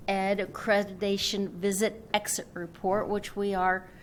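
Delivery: loud, slow, projecting to the room; teacher voice. Real, not acted. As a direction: project like a teacher to a noisy class. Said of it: "I am gonna use my teacher voice".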